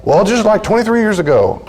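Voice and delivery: high, accusing mock jab; hammed-up spousal accusation; acted dredging up the past. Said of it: "they bring out the past".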